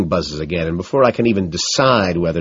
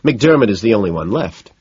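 usual speaking voice

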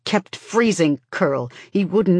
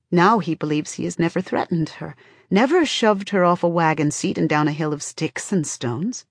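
plain reading voice

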